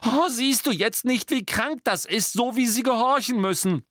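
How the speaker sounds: put-on agitated young woman, near tears; upset girl. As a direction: plead in distress for the elves. Hermine pleads upset.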